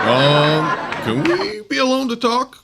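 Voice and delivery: high, jittery nervous comic voice; Don Knotts impression. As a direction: play it twitchy and flustered. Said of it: "play Don Knotts".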